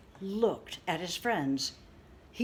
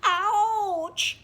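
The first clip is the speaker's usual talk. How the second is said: high, loud pained moan; moaning ouch; 13 semitones higher, 10 dB louder than usual. Acted. Little bird moaning after getting bonked on head. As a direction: cry out like a hurt little bird. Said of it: "moaned Bird".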